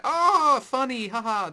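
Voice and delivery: high, loud hammed-up "haha" of forced amusement; mock fake laugh. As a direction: play the cringe gag's fake laughter. Mocking the gang's laughter at gag.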